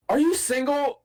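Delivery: high, coy lady voice; mock high female voice; flirty woman.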